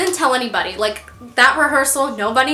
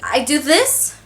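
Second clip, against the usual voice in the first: usual speaking voice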